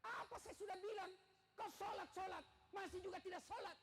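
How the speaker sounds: husband's voice climbs loud and high; husband scolding wife for skipping prayer, loud and high. Retold or imitated. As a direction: raise the voice at the wife who won't pray. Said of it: "suara mulai dita".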